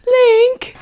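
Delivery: high-pitched princess impression; Zelda. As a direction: call out high in a put-on game-character voice. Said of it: "all the Zelda voice acting".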